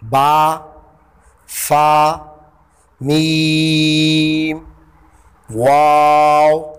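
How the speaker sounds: ब, फ, म, व drawn out slow as model sounds; Arabic letter demo. Shown as a demonstration. Teacher modelling lip letters, drawn out and chanted. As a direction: sing out each lip letter slowly for students. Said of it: "सिर्फ चार हरूफ अदा होते हैं".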